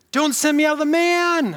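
mock demon plea. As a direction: plead in a hammed-up high voice. Preacher voices the demon's plea.

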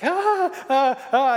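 high loud repeated yelps; high frightened ah ah ah; imitated scared scream. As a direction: cry out like a scared toddler. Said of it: "she's like".

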